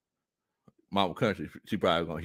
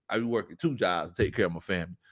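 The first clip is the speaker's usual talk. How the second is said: ordinary talk, nothing marked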